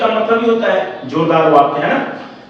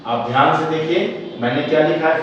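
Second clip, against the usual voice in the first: ordinary delivery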